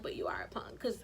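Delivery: soft unvoiced jab; hushed mocking whisper; whispered tease. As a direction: whisper the put-down behind his back. Teasing roommate under her breath.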